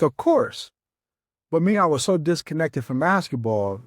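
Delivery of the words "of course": high, rising eager yes; eager of course. Retold or imitated. Telling the assistant he'd gladly go to the All-Star game, eager and high. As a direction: jump at the offer, pitch shooting up. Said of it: "I'm like".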